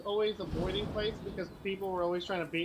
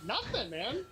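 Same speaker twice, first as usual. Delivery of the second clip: high, mock-innocent lilt; very sarcastic; 6 semitones higher, slower than usual. Answering a bully's challenge, sarcastic innocence. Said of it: "very sarcastic with people".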